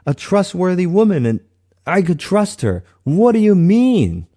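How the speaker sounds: retold outburst at God, climbing to a pained cry; angry plea to God. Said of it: "I remember I was so angry with God".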